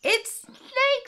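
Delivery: very high mouse squeal; excited mouse. A narrator's reading. Mouse spots snake ahead, high and excited. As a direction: announce it in a high, delighted pipe.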